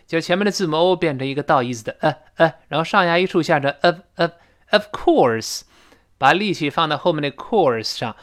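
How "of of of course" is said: very slow of, of, of course; slow of course drill; weak of. Shown as a demonstration. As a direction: drill the reduced of slowly. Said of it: "前面几乎听不到了".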